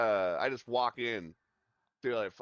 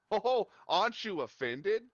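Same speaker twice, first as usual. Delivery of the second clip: higher and slower, mock-puzzled; surprised customer; 9 semitones higher than usual. Customer surprised the barbers aren't offended, asking higher and slower. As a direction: ask it puzzled, drawn out.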